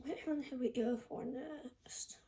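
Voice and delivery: soft unvoiced whisper. Real, not acted. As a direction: whisper it to yourself. Quietly choosing next toy.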